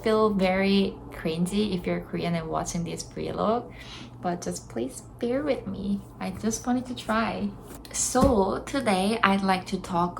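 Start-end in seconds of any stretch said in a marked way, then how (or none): none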